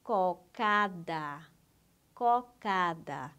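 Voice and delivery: "Cocada" drawn out in separate syllables, low; very slow reading. Shown as a demonstration. Teacher showing students how to read the word below, very slow reading. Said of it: "Leia bem devagar a palavra".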